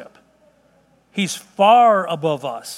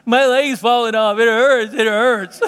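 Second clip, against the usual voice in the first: imitating wailing daughter; 3 semitones higher, 4 dB louder, slower than usual. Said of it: "with the wailing and the, you know, crying".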